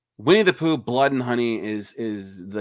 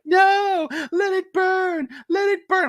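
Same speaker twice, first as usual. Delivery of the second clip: much higher, loud shrieking "let it burn"; Laurie's trailer shout; 4 dB louder, slower than usual. Retold or imitated. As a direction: shriek it like a woman defiant in a fire. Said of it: "she's like".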